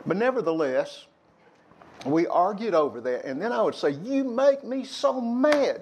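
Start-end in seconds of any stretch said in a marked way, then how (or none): 3.9-5.8 high, slow mock pout of a child; slow, high childish complaint; childish anger. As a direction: whine it like an angry kid. Childhood quarrel with sister.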